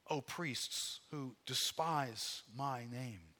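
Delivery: God making his case, emphatic and measured; dramatic scripture reading. Acted. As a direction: declaim the prophet's words with weighty pauses. Reads scripture as God's argument.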